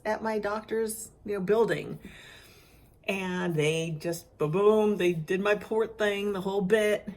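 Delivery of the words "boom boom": usual speaking voice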